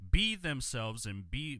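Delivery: ordinary talk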